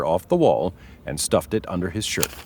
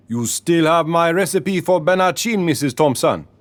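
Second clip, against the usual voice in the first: put-on higher-pitched Caribbean accent, salesman charm; 8 semitones higher than usual. A narrator's reading. Leroy greeting a customer in Caribbean accent. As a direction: lay on the Caribbean lilt for the customer. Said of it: "He spoke in a deep Caribbean accent".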